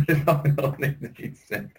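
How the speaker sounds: slow, laughing repeat. Real, not acted. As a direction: say it again through laughter. Punchline repeated while laughing.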